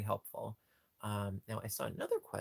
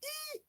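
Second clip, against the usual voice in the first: tiny eek squeak; 6 dB quieter than usual. Real, not acted. Sees the rat toy.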